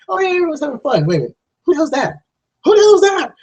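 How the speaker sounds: high, rising panic, building to a yell; mock alarmed moviegoer. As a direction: play a viewer spotting something wrong, voice climbing. Acting out a confused moviegoer.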